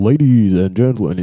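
loud, rolling American emcee call; announcer. Shown as a demonstration. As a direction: project it like a showman. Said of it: "I call".

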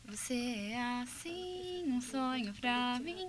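slow singing with lips almost closed; mouth barely open. Shown as a demonstration. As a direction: sing it without opening the mouth. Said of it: "Abre a boca pra cantar".

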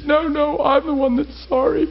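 imitating a crying apology, high-pitched; high, emotional mock-tearful protest; tearful wife. Crying wife apologizes to her husband in the yard, tearful imitation. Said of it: "gasping for breath and crying".

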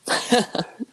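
short high laugh; a quick amused chuckle; light laugh. Real, not acted. Laugh at Theoden's speed.

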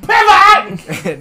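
yelled high and loud; shouted Pivot. Acted. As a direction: belt out Pivot.

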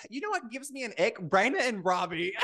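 high, snide imitation of a hater; mocking commenter. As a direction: sneer it like a troll in the comments. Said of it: "Someone in the comments are gonna be like".

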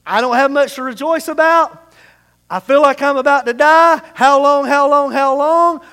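loud lament. Acted. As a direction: plead with heaven, overwhelmed. David's lament voiced again.

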